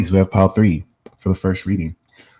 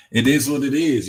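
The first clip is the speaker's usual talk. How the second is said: higher-pitched hammed-up Brooklyn accent; playful Brooklyn accent; 6 semitones higher than usual. Streamer shrugging off accent comments, playful Brooklyn accent. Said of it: "I do have a B K accent".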